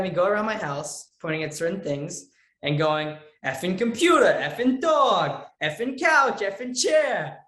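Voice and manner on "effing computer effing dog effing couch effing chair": loud, high Italian-American cursing; punchy practice swearing, Italian accent. Shown as a demonstration. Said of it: "saying the F word correctly in an Italian accent".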